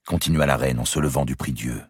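ordinary delivery, nothing marked

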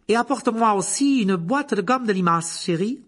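high, loud woman calling out; woman's voice. A narrator's reading. Woman sending someone to the cellar for sweets, loud high call. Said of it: "dit une voix de femme".

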